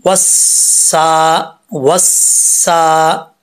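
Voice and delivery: वस्सा with the long aa stretched slowly; slow tajweed recitation; drawn-out Quranic recitation. Shown as a demonstration. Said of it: "इसको लंबा करेंगे।".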